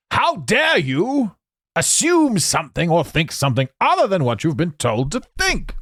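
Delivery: high, indignant scolding; hammed-up offended accuser; mock outrage. Acts out outraged inclusivity enforcers.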